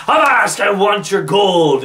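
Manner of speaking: loud, hammed-up pirate demand; pirate bellow. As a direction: boom it out like a pirate robbing a ship. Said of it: "So he's like".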